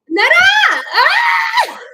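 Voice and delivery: high, loud cry of triumph; shrieking the name with glee; joyful shout. Real, not acted. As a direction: yell the name out in delight. Shouting the name finally right.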